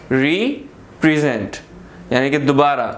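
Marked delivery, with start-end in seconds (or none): 0.1-1.6 रिप्रेजेंट slowly as re, pause, present; two-part split. Shown as a demonstration. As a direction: draw out RE, then say present as one unit. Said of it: "दो दफ़ा पढ़ने में आ रहा है".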